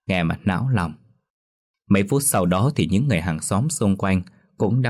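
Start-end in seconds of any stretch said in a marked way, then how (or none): none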